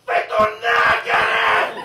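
slow, high mock shout from a skinhead. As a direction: yell it across the street like a skinhead, laughing. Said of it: "a skinhead would shout".

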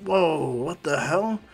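drawn-out astonished gasp; stunned slow whoa. Retold or imitated. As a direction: gawk at the wreckage, slowly. Everyone stunned by destruction.

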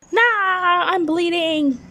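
slow, high mock alarm; fake injury. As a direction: pretend you're hurt.

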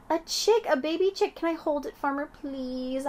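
high, breathless begging squeal; thrilled girl. A narrator's reading. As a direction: beg to hold it, over the moon. Junie thrilled by chick.

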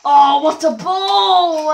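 slow, loud mock commentator cry of "ball"; mock commentator call. Gamer mocks his own shot, loud slow commentator call.

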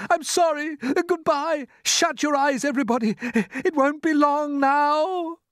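high-pitched farewell, drawn out at the end; desperate cry. A narrator's reading. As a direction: cry out final goodbyes in panic. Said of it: "cried James".

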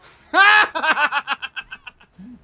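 big, loud cackling laugh out of nowhere; loud burst of laughter. Real, not acted. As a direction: crack up hard at the screen. Laughing at opponent's backstep.

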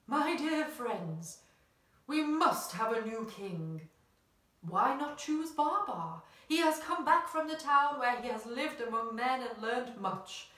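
put-on slow, lightly quavering old voice; quavering old elephant. A narrator's reading. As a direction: speak slowly like a wise old elephant. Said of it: "said in his quavering voice".

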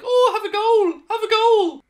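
high, loud mocking singsong; mock opponent. Gamer mocking the other team's lucky goal, high mock singsong. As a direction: taunt it like the smug opponents. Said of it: "and they're like".

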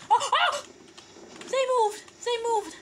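high, loud "they moved!"; loud surprised cry; startled shout. Real, not acted. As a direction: yelp in alarm as they move. Enemies suddenly move.

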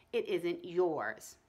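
slow, flat, stubborn reply; calmly refuse, giving no ground. Acted. Howie calmly and flatly refuses Ramona, stubborn and slow. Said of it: "flatly and calmly".

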